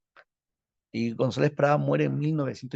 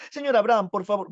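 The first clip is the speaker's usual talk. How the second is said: high, louder calling out; 6 semitones higher, 4 dB louder than usual. Acted. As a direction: call out over the crowd, pitched up. Vallejo suplica a Valdelomar. (llamando fuerte y agudo)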